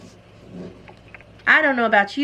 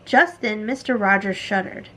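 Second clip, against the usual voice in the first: ordinary delivery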